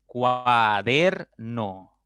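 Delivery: "Cuaderno" very slowly as cua - der - no; syllable by syllable. Shown as a demonstration. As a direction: draw "Cuaderno" out in three separate beats, cua, der, no. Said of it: "pueden descomponerse en tres sílabas".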